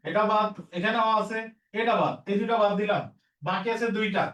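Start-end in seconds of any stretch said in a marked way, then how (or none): none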